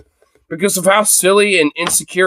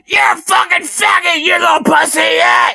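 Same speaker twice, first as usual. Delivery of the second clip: high, hammed-up angry yell; mock yelling. Streamer acts out guy yelling at them, high mock yell. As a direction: imitate a ranting man shrieking insults. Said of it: "if this guy was yelling".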